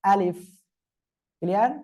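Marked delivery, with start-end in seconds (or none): 0.0-0.4 আলিফ with a light puff of air on the final f; teacher's model আলিফ, airy final f; articulation demo. Shown as a demonstration. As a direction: say আলিফ carefully, releasing a little air at the end. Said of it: "হালকা বাতাস বের করে উচ্চারণ".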